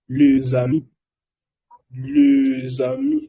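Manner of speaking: les amis with a z linking, le-za-mi; liaison demo. Shown as a demonstration. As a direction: link les amis with a z sound. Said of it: "escuchar cómo lo voy a leer". (les amis con enlace de zeta)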